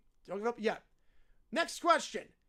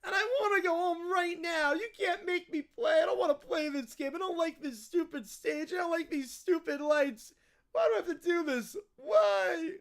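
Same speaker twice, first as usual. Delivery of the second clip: tantrum building to a wailed why; mock-crying bratty little kid; whiny kid. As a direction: complain in a tearful child's whine. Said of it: "that's a lot of emotions".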